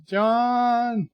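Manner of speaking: high, drawn-out name call; hammed-up greeting cry; mock calling out. Reads a chatter calling out John.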